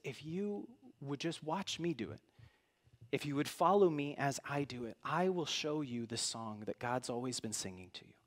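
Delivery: hushed, low, heartfelt appeal; gentle invitation. Acted. Preacher voicing Jesus inviting listeners to follow, gentle and hushed. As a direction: softly draw listeners in with tenderness.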